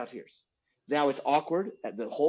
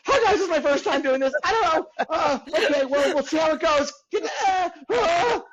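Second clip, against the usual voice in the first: high, loud, near-tears first-time captain; a new pilot losing it over the intercom; nervous pilot breaking down mid-announcement; panicky rookie pilot; 11 semitones higher, 6 dB louder than usual. Retold or imitated. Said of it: "If you get there".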